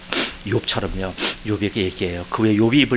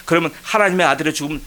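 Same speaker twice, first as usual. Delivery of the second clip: ordinary talk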